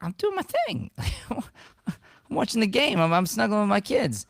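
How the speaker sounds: high squeaky put-on voice, brushing her off; mock whiny husband. Husband mocks his own excuse to his wife in a high whiny voice. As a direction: whine it high like a lazy kid.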